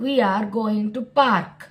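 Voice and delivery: very slow, word by word; slow model sentence. Shown as a demonstration. Teacher reading an example slowly to students. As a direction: lay each word down for the class.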